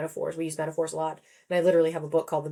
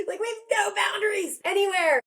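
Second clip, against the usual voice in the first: very high, held exclamation; exasperated mock shout. Author despairing at work calls in hospital, high exasperated cry. Exclaiming about lack of boundaries.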